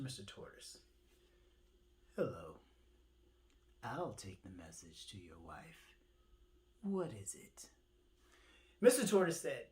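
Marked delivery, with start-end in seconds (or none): 2.2-7.7 much lower, soft and hushed; a quiet helper bird offering aid; low, gentle hushed bird voice; kind bird. Acted.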